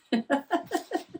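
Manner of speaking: high rising giggle; sheepish laugh. Real, not acted. Laughs at her crazy intro.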